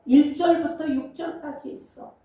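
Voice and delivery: normal talking voice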